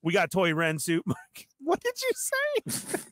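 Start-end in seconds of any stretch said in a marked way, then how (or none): 1.6-3.0 very high, drawn-out disbelief; incredulous squeal. Real, not acted. Host questioning what was just said, in an incredulous squeal.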